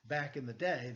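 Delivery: plain reading voice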